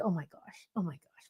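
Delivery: a quiet head-shaking oh my gosh; husband shaking his head. Retold or imitated. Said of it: "Ken's shaking his head like".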